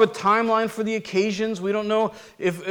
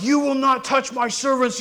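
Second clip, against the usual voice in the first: riled; 6 semitones higher than usual. Retold or imitated. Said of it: "there's no riledness".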